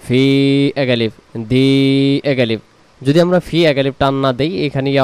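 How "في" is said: slow, drawn-out chanted recitation; Quran recitation. Shown as a demonstration. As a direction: recite it slowly, stretching the vowels.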